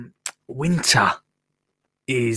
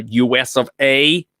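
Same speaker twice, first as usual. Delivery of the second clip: ordinary delivery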